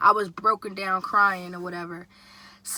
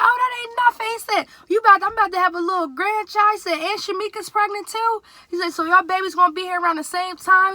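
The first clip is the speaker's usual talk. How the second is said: much higher, louder, thrilled dad; a dad bursting with joy; dad celebrating a coming grandchild, high and loud; excited dad; 9 semitones higher, 5 dB louder than usual. Retold or imitated.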